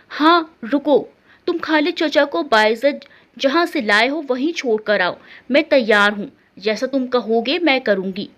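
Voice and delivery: ordinary delivery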